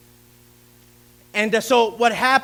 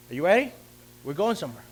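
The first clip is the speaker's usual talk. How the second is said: ordinary delivery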